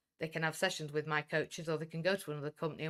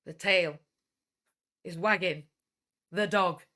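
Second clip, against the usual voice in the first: "The tail... is wagging... the dog", each part loud with a pause after; emphatic pauses; 8 dB louder, slower than usual. Real, not acted. Speaker answers own question, "The tail / is wagging / the dog" in loud separate chunks. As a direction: punch "tail", "wagging" and "dog", pausing between.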